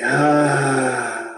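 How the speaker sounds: a sigh like everything's gonna be okay; relieved sigh. Shown as a demonstration. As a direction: breathe out relief. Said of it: "like everything's gonna be okay".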